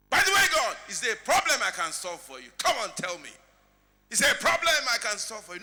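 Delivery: loud hail, then forceful questioning; mock insistent offer. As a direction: press God loudly to share a problem. Mock caller offering God help.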